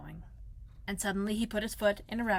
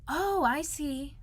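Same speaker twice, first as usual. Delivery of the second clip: high, slow dawning "oh"; awed understanding; 7 semitones higher, much slower than usual. Acted. Pooh takes in Owl's explanation, slowly and in awe. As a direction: draw it out, high and impressed.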